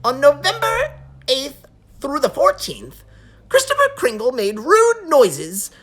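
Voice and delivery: high, loud nervous elf; fretting little elf official; fussy elf voice. Acted. Elf reads the list.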